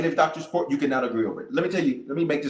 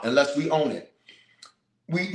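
normal talking voice

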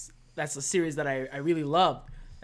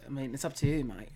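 mock British accent; 4 semitones lower, 6 dB quieter than usual. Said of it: "If I'm doing this accent".

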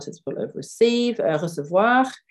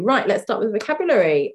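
ordinary talk

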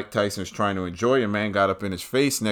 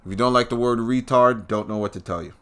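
usual speaking voice

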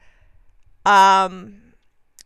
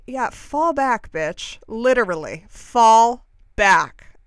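retold telling-off; 7 dB louder, much slower than usual. Retelling her confrontation with the woman.

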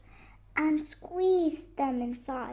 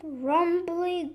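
ordinary delivery, nothing marked

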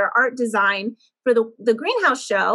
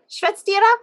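"Schwetztira" higher, lifted as a question; tentative, high "Schwetztira", seeking approval; unsure question; 7 semitones higher than usual. Shown as a demonstration. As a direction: offer "Schwetztira" for checking, voice pitched up. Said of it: "Did I say that right?".

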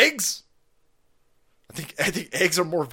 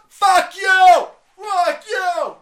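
put-on much higher, louder, bratty kid shouting back; kid yelling; 14 semitones higher, 10 dB louder, much slower than usual. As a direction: scream it like a defiant child.

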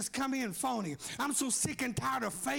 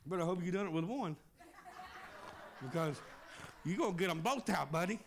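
chuckling through the words, lower; laughing; 6 semitones lower, 3 dB quieter, faster than usual. Real, not acted. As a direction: tease with a laugh in the voice. Joking about plucking out eyes.

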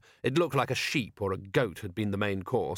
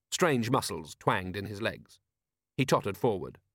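plain reading voice

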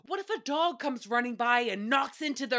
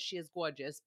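muttered aside; 8 semitones lower, 9 dB quieter than usual. Real, not acted. Repeated as low aside.

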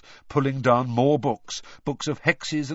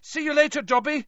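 calling out; 3 dB louder than usual. A narrator's reading. Harry calls back while running off.

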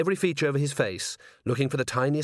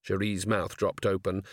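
ordinary delivery, nothing marked

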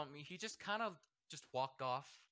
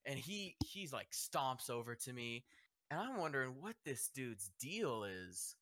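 ordinary delivery

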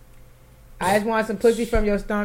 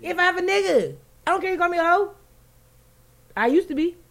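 high, pressed rant; heated rant; 10 semitones higher, 3 dB louder than usual. Real, not acted. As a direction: rant at them, fired up. Ranting about being called hoe.

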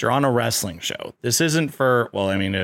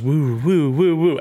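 dream wobble sound; 4 semitones higher, 3 dB louder than usual. Retold or imitated. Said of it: "And then it goes".

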